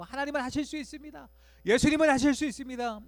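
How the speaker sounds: high, then loud cry on 예수님은; fervent prayer. Pretend. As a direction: proclaim it in earnest prayer. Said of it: "이렇게 기도하면". (간절히 외치는 기도)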